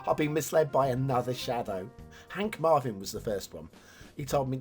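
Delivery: usual speaking voice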